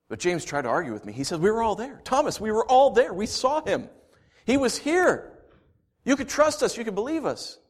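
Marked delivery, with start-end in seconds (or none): 1.4-7.6 higher urgent pleading; pleading insistence. Acted. James pleading with Thomas, higher urgent voice. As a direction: press him to believe you.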